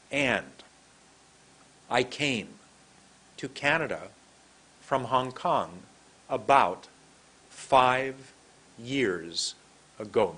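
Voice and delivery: slow short groups with clear pauses between; two words together. Shown as a demonstration. Teacher demonstrating the next step to learners, slow paired words. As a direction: say it in small chunks, pausing after each. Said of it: "two words together".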